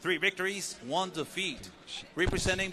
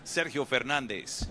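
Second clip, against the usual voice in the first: usual speaking voice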